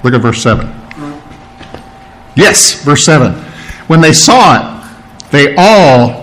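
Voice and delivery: ordinary delivery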